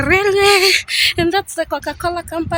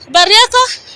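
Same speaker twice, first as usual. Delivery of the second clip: raised voice asking the clerk at the counter; loud calling out; 5 semitones higher, 7 dB louder than usual. Real, not acted. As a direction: speak up over the station noise. Asks railway clerk loudly in Swahili.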